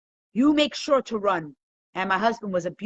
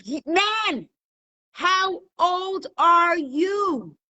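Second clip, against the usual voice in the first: high, very slow exasperated shout; hammed-up angry judge losing patience; 8 semitones higher, 3 dB louder, much slower than usual. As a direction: stretch every word in loud exasperation. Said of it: "The judge is starting to get angry".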